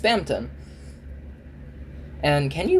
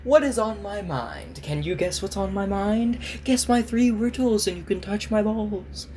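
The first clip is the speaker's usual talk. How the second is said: lightly mystical fortune teller voice; 5 semitones higher than usual. Pretend. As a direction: play a hammy fortune teller. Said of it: "I sound like a fortune teller".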